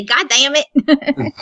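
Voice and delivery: slow, drawn-out southern "God damn it"; southern drawl. Shown as a demonstration. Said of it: "At least it's charming when I say".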